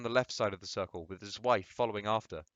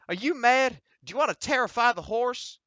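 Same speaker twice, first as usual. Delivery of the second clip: much higher, loud outraged voice; outraged shout; 13 semitones higher, 7 dB louder than usual. Acted. The rider shouts at Frank for scaring the horse. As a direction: yell at him for scaring the horse.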